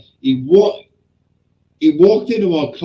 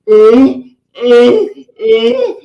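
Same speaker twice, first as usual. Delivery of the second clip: loud, high imitated "uh" cries; dazed grunts; 9 semitones higher, 8 dB louder, much slower than usual. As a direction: act out his stunned yelps. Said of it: "he went like this".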